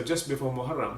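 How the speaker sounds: normal talking voice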